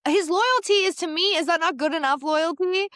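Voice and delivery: high, loud mock protest; indignant retort. Son defending his boyfriend to his mother, indignant. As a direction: pitch it way up in exasperated defense.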